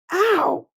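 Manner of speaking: high, loud yelp of pain; goblin yelp. A narrator's reading. Goblins crash into the prickly hedgehog, yelping.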